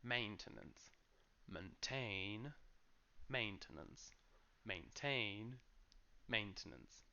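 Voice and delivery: Maintenance stressed on MAIN, Maintain on TAIN rising; contrasting stress drill. Shown as a demonstration. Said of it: "the main stress is in the first syllable".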